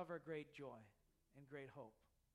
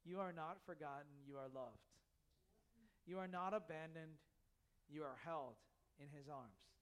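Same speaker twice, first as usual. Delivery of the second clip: soft reassurance; 5 semitones lower, 6 dB quieter than usual. Real, not acted. Quiet assurance to the congregation.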